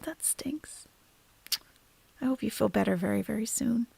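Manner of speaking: consoling whisper. Real, not acted. Consoling a sick viewer.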